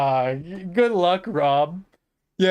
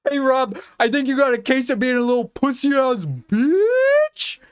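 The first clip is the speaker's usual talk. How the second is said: higher, put-on mocking voice; taunting voice; 5 semitones higher than usual. Streamer mocking Rob, taunting put-on voice. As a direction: needle him in a silly voice.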